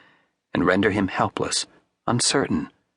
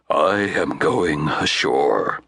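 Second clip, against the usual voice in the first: slow, low deliberate statement; slow grave uncle; slowly; 6 semitones lower, much slower than usual. A narrator's reading. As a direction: say it slowly and heavily. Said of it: "he said slowly".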